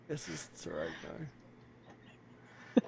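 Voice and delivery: breathy whispered aside. Real, not acted. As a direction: whisper it under your breath. Aside about racists.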